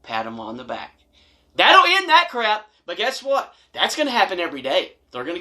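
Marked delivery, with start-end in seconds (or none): 0.0-1.0 quiet demo. Shown as a demonstration. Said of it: "And then at the end".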